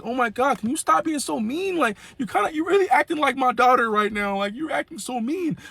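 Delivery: high, whiny grandma complaint; hurt nana pouting. Retold or imitated.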